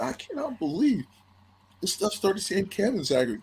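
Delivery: high-pitched retold indignation; upset disbelief. Athlete recalling his misspelled recruiting mail, upset disbelief. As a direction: protest high, replaying the offense. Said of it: "That really upset me".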